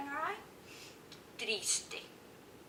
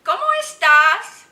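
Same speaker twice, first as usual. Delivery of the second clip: very loud, high question; big projected question, rising at the end; teacher quizzing children; 9 semitones higher, 16 dB louder than usual. Shown as a demonstration. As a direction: call it out to the class. Quizzes children with next picture.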